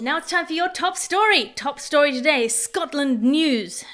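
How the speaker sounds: high, loud top-story announcement; news announcer. Acted.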